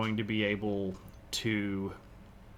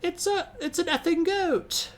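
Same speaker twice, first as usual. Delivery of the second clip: put-on very high squeaky toddler voice with thick accent; little kid impression. Streamer imitates kid spotting a backyard goat, high little-kid voice. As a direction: imitate a tiny kid, pitched way up. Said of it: "the little kid has this really thick accent".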